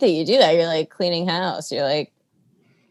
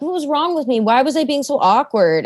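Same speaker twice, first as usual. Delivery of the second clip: higher-pitched, fretful replay of self-doubt; mock self-questioning; 7 semitones higher than usual. Comedian replaying their thoughts after awkward conversations, self-questioning. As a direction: fret aloud in a raised voice.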